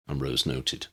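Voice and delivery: plain reading voice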